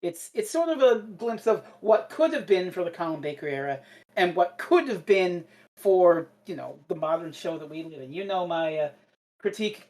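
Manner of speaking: ordinary delivery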